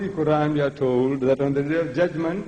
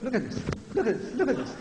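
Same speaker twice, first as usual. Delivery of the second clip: mock feeble voice; 4 semitones higher, 6 dB quieter, faster than usual. Demonstrates feeble old man's voice.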